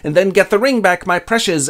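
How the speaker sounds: a quick squeak of a sly creature; mock Gollum voice. Gollum's words in retelling.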